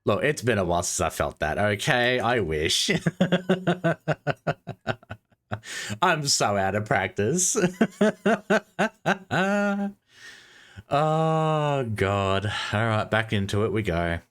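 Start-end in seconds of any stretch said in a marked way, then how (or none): 2.9-5.1 giggle. Real, not acted. Laughing at own reaction. 7.6-9.9 giggle. Real, not acted. Laughing at own reaction.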